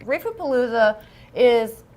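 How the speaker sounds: ordinary talk, nothing marked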